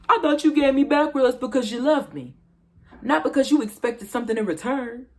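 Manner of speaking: high, loud, wounded protest; indignant reproach. Acted. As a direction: throw it back as an accusation. Character quotes partner's indignant reproach.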